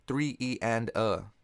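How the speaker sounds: slow teaching count; slow spoken subdivision. Shown as a demonstration. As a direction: say "three E and a" slowly and evenly. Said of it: "We do this by saying".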